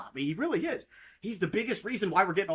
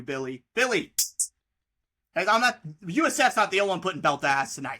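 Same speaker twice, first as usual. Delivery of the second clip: ordinary talk, nothing marked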